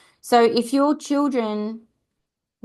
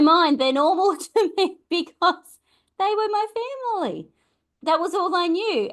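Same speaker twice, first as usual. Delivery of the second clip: laughing it off mid-sentence, pitched up; wry laugh at how normal it seemed; 10 semitones higher than usual. Real, not acted. Laughing at her own normal.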